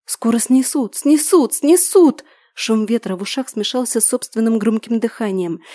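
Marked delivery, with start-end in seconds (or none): 0.1-2.2 building from tense to high, loud panic; each repeat climbs higher and louder; rising panic. Acted. Panic rising as she runs.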